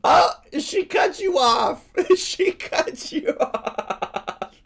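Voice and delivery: high, loud squeal of laughter; laughing. Real, not acted. Streamer cracking up as the alert gets cut off, laughing. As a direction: crack up mid-sentence, words stretching into laughter.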